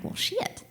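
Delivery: breathy whispered drawled "Well, shit"; "shit" drawn out into "shee-it"; Southern well shit. Retold or imitated. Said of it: "That's another one".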